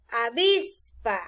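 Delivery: slow "Avispa", VIS stretched long, PA detached; stress on middle syllable. Shown as a demonstration. Teacher giving an example to students, stress on middle syllable. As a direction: hold the VIS of "Avispa", then drop to PA. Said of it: "avispa lleva el acento".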